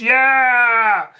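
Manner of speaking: put-on excited whoop, held long; mock party cheer. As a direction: imitate a reveller hollering along. Mimics vlogger partying with locals.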